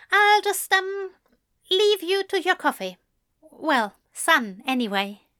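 high, hesitant fussy gentleman; a nervous, eager voice lifted high; tentative Alistair. A narrator's reading. Alistair's awkward exit.

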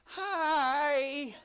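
very high, loud, drawn-out hello; gushing mock greeting, sung out way too keenly; overeager greeting. Biker waving at a passing rider, mock overeager. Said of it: "it's not like that".

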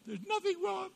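very high squeaky wife voice; high-pitched mock of a teary wife. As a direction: play the tearful wife in falsetto. Wife voiced while crying.

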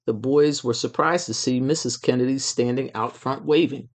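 ordinary delivery

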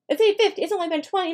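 realizing the date is only twenty minutes in; dismayed double-take. Shown as a demonstration. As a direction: repeat the time in mock horror. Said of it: "I look at my watch".

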